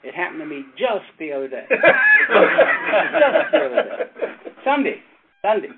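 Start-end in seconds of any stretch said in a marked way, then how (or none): 1.8-3.5 loud burst of laughter; hearty laugh mid-sermon; big laugh. Real, not acted. Preacher laughing at own story.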